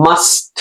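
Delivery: MUST with a long S, then a released T; slow MUST down, land the T last; separate final T. Shown as a demonstration. Teacher demonstrating for students, MUST with separated T. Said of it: "পরের টি সাউন্ডটা".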